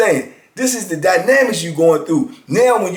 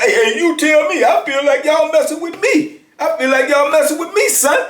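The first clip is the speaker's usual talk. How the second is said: high-pitched mock complaint from the cellmate; whiny inmate; 11 semitones higher than usual. Inmate whining to the guards about his tray, high mock voice. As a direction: play him pleading that he's being messed with. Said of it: "He talking about".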